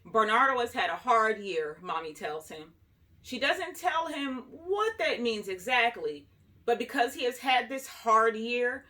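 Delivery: ordinary delivery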